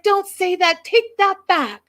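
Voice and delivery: high, loud frantic protest; hammed-up alarmed yelling; mock panicked scolding. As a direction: imitate a frightened mom yelling at her kids. Acted frightened mother scolding her daughters.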